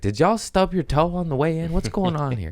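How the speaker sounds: high, teasing jab at a flat crowd; mock concerned teasing. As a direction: needle the crowd like you're worried for them. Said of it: "I was like".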